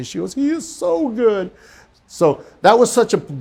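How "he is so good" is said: high, slow, drawn-out rejoicing; retold rejoicing. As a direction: imitate her marveling in a high voice. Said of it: "she started rejoicing with me".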